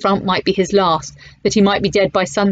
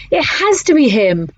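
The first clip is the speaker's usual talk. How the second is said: slow, higher, rising certainty; awed conviction; 7 semitones higher than usual. Acted. Bertie to the narrator, slow and awed. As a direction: say it slowly, sure now. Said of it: "he breathed".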